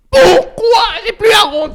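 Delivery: high, loud outraged cry; mock outraged shout. Streamer yelling a mock complaint at the confetti guy, high and loud. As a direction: yell it like you've been robbed.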